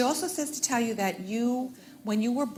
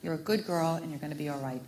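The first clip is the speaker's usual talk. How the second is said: tender reassurance; 5 semitones lower, 6 dB quieter, faster than usual. Retold or imitated. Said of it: "she keeps telling me".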